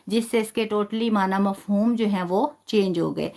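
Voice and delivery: normal talking voice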